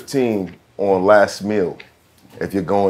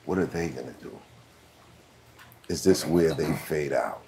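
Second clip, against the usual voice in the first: much softer, hushed gossip; whispered doubts; 5 semitones lower, 11 dB quieter than usual. Retold or imitated. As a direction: murmur it like people whispering behind your back. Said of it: "I know there were whispers".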